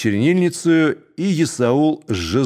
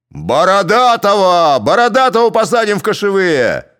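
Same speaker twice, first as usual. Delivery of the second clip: loud high chant of the name; rally the crowd; shout; 7 semitones higher, 5 dB louder than usual. A narrator's reading. Cossacks shouting for Borodaty, loud.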